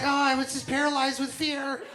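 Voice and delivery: fake innocent excuse.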